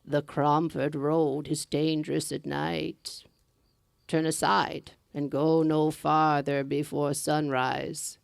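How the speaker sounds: put-on slow, low, otherworldly old woman; old woman in green. Second old woman warns.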